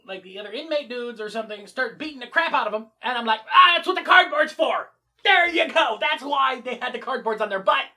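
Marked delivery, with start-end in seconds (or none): 3.5-7.8 loud, high, gleeful aha; suddenly getting it, loudly; excited realization. Real, not acted. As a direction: burst out with discovery.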